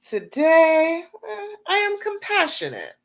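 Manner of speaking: high, passionate declaration said with full conviction; heartfelt affirmation. Shown as a demonstration. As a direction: proclaim it like the truest thing you've ever said. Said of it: "say that affirmation like you believe it".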